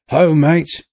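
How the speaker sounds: loud, high sailor's call; cry out. A narrator's reading. Sailor calling his mates with a loud cry. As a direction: hail the mates with a shout. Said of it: "he cried".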